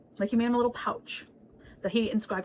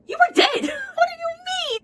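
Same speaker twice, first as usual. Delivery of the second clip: high cracking yell of disbelief; incredulous shout; 5 dB louder, slower than usual. Real, not acted. Vlogger yelling at the Emperor's nonsense, high cracking shout. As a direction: shriek at him in disbelief. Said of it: "vlogger's own outburst".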